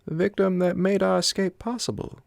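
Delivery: high-voiced Swede, hushed in intent but still loud; the Swede pointing at the body, grimly; the Swede, quietly. A narrator's reading. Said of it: "he said quietly".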